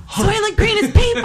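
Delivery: high, loud movie shout; dramatic shout. Acted. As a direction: shout it like the film's big reveal. Quoting the movie line.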